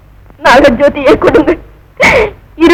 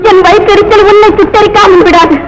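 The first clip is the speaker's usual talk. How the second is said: higher, raised voice laying a curse; furious curse; 4 semitones higher than usual. Acted. Wronged wife curses the proud woman, furious. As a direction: curse her with rising fury.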